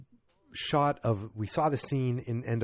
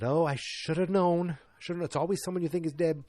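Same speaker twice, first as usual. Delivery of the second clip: exasperated realization; 6 semitones higher than usual. Retold or imitated. Film fan recalls reacting to a twist, exasperated realization. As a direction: kick yourself for not guessing.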